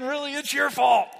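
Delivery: loud, high retort, punching "your"; mock accusing shout. Pastor voicing a blaming spouse, a mock shout. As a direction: throw the blame back with a shout. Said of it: "That's saying".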